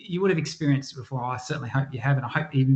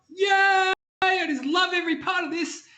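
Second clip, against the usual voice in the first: mock gushing cheer. Imagined worker who loves every moment, hammed-up cheering. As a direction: shout it like a job is pure joy. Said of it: "everyone's like".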